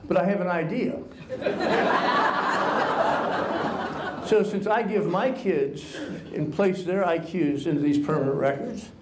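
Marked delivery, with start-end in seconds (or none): 1.0-4.2 high breathy cackle breaking out; burst of laughter. Real, not acted. Telling the IQ prank on Bernie, a real burst of laughter.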